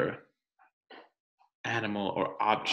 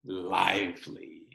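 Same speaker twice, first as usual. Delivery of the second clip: put-on reading to children; 3 semitones higher than usual. Teacher reading the rock book to her class, storytime narration. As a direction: read it aloud for the class.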